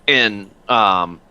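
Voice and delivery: ordinary talk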